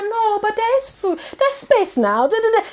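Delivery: high, slow, pleading mum voice; mum's high pleading reply. Retold or imitated. The mum answering her son about the freezer space, high and pleading. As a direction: play the mum defending herself. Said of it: "she's like".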